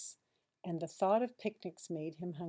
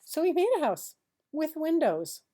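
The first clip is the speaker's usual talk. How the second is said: higher and louder, rising to the finish; triumphant build; 5 semitones higher, 7 dB louder than usual. A narrator's reading. Narrator excitedly telling the child Harold lands home. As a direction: announce the happy landing.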